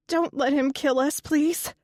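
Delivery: high, frightened plea; pleading. A narrator's reading. Captive woman begging the lizard, high frightened plea. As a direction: beg for your life, voice climbing. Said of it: "one of the females pleaded".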